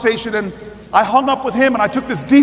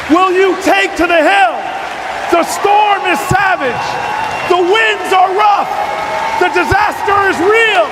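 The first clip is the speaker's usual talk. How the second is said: preaching at full force; 7 semitones higher than usual. Acted. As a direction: rouse the crowd to stand up, line by line. Rally close to the crowd.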